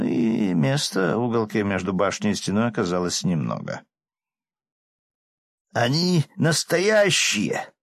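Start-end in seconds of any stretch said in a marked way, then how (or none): 5.7-7.7 high, slow excited cry; excited shout. A narrator's reading. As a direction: cry it out in disbelief. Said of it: "Этот крик".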